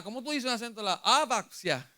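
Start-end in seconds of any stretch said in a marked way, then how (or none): 1.2-1.9 "Abaxia" with the first A accented; stress on first A. Shown as a demonstration. Preacher trying out a Greek word, stressing the A of "Abaxia". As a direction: lean on the opening A of "Abaxia". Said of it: "es con acento en la A".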